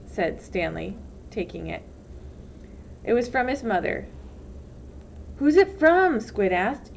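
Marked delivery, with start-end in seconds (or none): 5.4-6.2 put-on nosy boy. A narrator's reading. Squid pestering Stanley.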